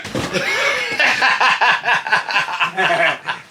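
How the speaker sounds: long, loud burst of laughter; real belly laugh. Real, not acted. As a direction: crack up at your own story. Laughing at own story.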